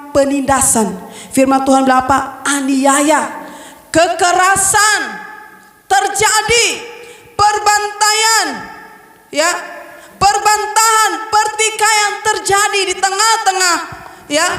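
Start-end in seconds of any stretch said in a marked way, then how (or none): 2.4-13.8 slow, high, weighty listing; emphatic preaching. Acted. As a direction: hammer each word home, slowly. (berkhotbah dengan tekanan lambat)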